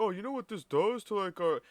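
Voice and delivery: high, mock-aggrieved whine imitating the customer; mocking whiny complainer. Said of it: "he's like".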